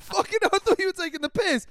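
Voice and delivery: high, loud, laughing exclamation. Real, not acted. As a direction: shout it through laughter. Laughing reply to friend.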